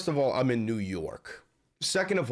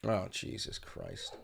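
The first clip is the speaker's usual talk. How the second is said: breathy groan; 7 semitones lower, 11 dB quieter, slower than usual. Real, not acted. Groaning at the news.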